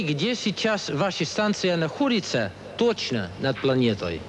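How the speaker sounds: usual speaking voice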